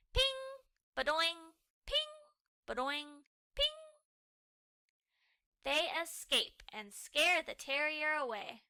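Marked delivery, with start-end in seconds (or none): 0.1-3.8 shield sound effects. Pretend.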